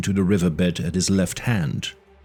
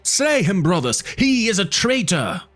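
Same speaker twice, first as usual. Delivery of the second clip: much higher howling war cry; howling tribesmen; 10 semitones higher than usual. A narrator's reading. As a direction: howl for vengeance. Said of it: "they howled".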